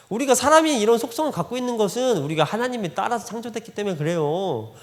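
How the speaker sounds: ordinary talk, nothing marked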